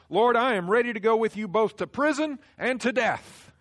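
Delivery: loud, high, eager declaration; bold vow. Acted. Peter declaring loyalty to Jesus, loud and high. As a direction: Peter proudly overpromising.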